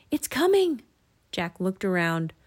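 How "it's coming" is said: high, urgent warning; alarmed high call; shouted warning. A narrator's reading. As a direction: warn them in a raised pitch. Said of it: "shouted Annie".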